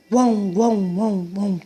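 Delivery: "guão" repeated, its vowels in one glide; repeated one-syllable "guão"; all run together. Shown as a demonstration. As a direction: say "guão" as one blended sound, several times. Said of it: "Tudo junto".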